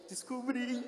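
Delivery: high, broken admission; choked admission. Retold or imitated. Patient admitting to psychologist, high broken voice. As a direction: let the voice crack on the word. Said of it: "E eu".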